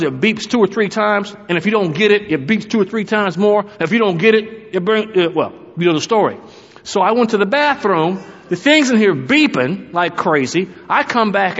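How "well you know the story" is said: ordinary delivery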